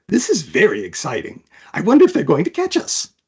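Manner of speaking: a grinning blue-clawed beast thrilled by danger; gleeful creature voice. Acted. Clawed companion clinging to the roof during the chase, giddy high voice.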